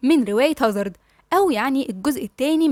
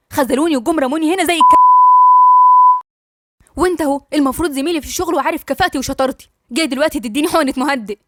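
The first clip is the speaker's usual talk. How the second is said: louder, rising bitter complaint; bitter outburst; 3 semitones higher, 6 dB louder than usual. Acted. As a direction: let the hurt spill out in anger. Yasmin's bitter outburst at doctor. (مرارة وغضب مكتوم)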